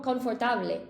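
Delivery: "confortable" with full Spanish vowels; Spanish "confortable", every syllable sounded; Spanish pronunciation. Shown as a demonstration. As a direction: say "confortable" the Spanish way, con-for-TA-ble. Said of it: "In Spain, people say".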